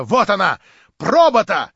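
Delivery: loud, high exclamation; cried out. A narrator's reading. Someone in the crowd reacts to the exit, shouting. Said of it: "крикнул голос".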